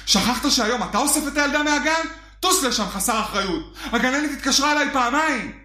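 scolding ex. Retold or imitated. Ex-wife scolding on phone.

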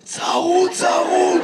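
mock hoarseness; imitated hoarse voice. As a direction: mimic a man who has lost his voice. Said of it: "ממה אתה צרוד".

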